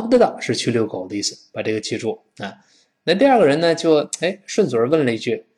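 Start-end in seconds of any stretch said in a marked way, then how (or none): none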